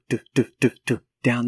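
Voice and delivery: rhythmic do-do-do noise; lightly sung thudding rhythm; imitated rolling rhythm. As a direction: tap out the rolling sound with your voice. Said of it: "this rhythmic noise".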